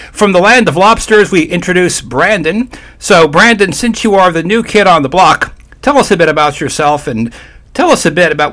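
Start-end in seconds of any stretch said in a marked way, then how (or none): 0.1-7.1 higher, louder announcer welcome; radio host introduction. Acted. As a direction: bring on the new guest like a show host. Host introduces the new guest.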